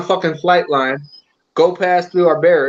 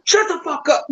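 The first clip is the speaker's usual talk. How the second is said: mock angry outburst; 10 semitones higher than usual. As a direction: imitate a heckler in a high voice. Quotes somebody's shouted interruption.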